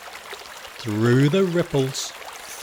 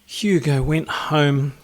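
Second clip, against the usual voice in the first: ordinary delivery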